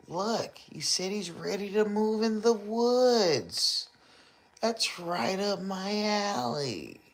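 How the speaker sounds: put-on high squeaky little kid; lightly voiced baby; mock baby voice. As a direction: squeak it out like a tiny child. Voicing the baby's supposed reply.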